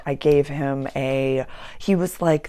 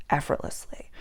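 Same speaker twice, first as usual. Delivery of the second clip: soft breathy whisper of the word; whispered effortlessly; 6 dB quieter than usual. Shown as a demonstration. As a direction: hush it out like a secret. Repeated, second whispered.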